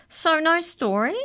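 slow, high, loud canine voice; hopeful canine leader. Acted. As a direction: draw out the question, pitched high. Canine leader hopes for story.

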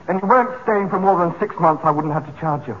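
ordinary talk

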